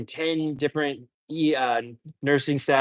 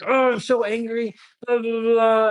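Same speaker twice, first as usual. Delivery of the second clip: imitating a riled patient, much higher; mock-angry ranting in a raised pitch; amped-up angry patient; 10 semitones higher than usual. Paramedic imitates an amped-up patient, mock angry. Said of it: "he was really amped up".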